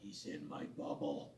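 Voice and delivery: low, voiceless whisper; retold whisper in ear. Friend whispering into storyteller's ear about an intruder, mock whisper. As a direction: imitate a confidential whisper. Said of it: "leans over into my ear and goes".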